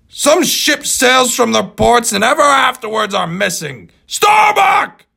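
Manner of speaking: ominous shout. Acted. Ahab's ominous farewell to mate.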